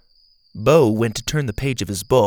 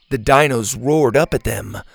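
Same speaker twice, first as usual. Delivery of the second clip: ordinary delivery, nothing marked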